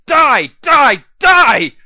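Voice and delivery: full-throated battle scream, very high and loud. A narrator's reading. Blackjack screaming at the rad scorpion, furious scream. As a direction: scream it as you smash the monster. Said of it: "I screamed again and again".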